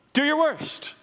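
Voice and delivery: defiant cry. Retold or imitated. Martyrs defy torturers, climax.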